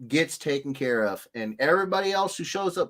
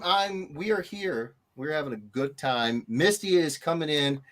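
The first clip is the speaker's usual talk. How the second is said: usual speaking voice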